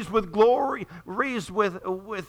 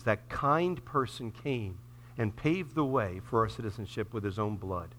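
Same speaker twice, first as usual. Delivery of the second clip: low, soft, unhurried prayer; 8 semitones lower, 6 dB quieter than usual. Real, not acted. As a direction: lead a quiet, earnest closing prayer. Leads congregation in closing prayer.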